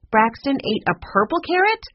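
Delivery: appalled, high rising question; incredulous. A narrator's reading. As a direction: question it in disbelief. Repeats the disbelieving question.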